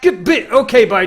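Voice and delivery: loud, high burst of glee; gleeful loud farewell; excited. Real, not acted. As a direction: yelp out a giddy goodbye.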